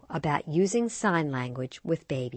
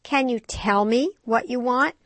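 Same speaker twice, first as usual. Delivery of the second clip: higher, slow coaxing mother; gentle prodding; 8 semitones higher, 5 dB louder than usual. A narrator's reading. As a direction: coax the toddler, slowly. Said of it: "she prodded".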